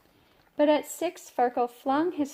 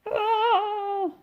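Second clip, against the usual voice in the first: high, loud frustrated scream; tantrum scream; 10 semitones higher, 4 dB louder than usual. Acted. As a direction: let out a furious yell.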